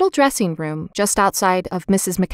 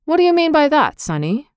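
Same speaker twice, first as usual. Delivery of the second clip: high-pitched, louder opening that drops away; curious; 8 semitones higher, 3 dB louder than usual. A narrator's reading. Man questioning little Benny, curious. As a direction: ask with lively curiosity. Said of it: "he asked curiously".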